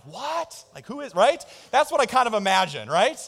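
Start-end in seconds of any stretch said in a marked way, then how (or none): 0.1-1.1 mock Pharisee surprise. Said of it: "the Pharisees are like".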